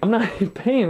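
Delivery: breaking into a laugh mid-line; laughing through it. Retold or imitated. Telling the dentist with a laugh. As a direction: giggle between the words.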